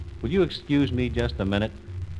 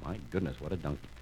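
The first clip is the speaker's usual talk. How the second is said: low, soft amazed aside; hushed aside; 8 semitones lower, 12 dB quieter, faster than usual. A narrator's reading. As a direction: remark softly under the story. Narrator's aside about the donkey.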